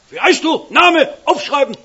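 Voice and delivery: bellowing high and loud like a bossy foreman; retold bellow. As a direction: bark the orders down at the recruit. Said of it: "brüllt mich an". (laut brüllender Vormann)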